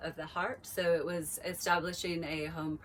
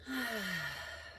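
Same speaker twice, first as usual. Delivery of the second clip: soft, long audible out-breath sigh; demonstrated sigh; 3 semitones higher, 4 dB quieter than usual. Shown as a demonstration. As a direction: let the breath fall out with a sigh. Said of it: "go ahead and sigh that breath out".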